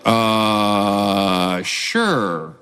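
long low drawn-out hesitation, then give in; mock reluctance, stretched out low; hesitant agreement. Dad answers eager son's offer, reluctant and drawn out.

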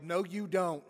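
loud, fast protest No, you don't; yelled. Retold or imitated. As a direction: shout it out in disbelief. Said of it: "And Harry yelled".

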